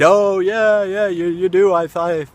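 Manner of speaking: high, loud chorus of agreement easing down; imitated eager agreement. Group at the photo shoot agreeing he's a lookalike, imitated eagerness. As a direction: act out a whole group chiming in.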